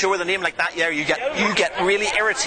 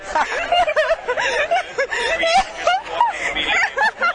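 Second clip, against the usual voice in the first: donkey laugh. Real, not acted. Hypnotised girl laughing.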